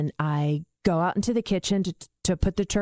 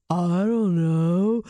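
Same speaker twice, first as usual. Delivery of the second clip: slow, drawn-out dazed reply; stoned dad; much slower than usual. Retold or imitated. Stoned dad being yelled at by mom, dazed and slow. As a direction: stretch the words out like someone very stoned. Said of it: "he is so stoned".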